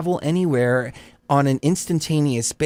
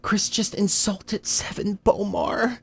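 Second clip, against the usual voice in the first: higher, hammed-up scandalized fan voice; mock shocked viewer; 9 semitones higher than usual. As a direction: mimic a viewer gasping in outrage. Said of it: "Somebody was like".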